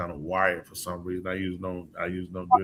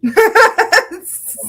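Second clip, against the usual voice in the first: loud high laugh; real laugh at the teasing; high burst of laughter; 14 dB louder than usual. Real, not acted. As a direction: let a big laugh burst out.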